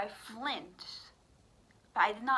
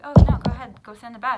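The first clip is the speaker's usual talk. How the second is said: loud, higher-pitched mock urging; urging parents; 4 semitones higher, 17 dB louder, faster than usual. Imitated parents sending her to the bed, loudly urging. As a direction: push them along loudly, imitating a parent.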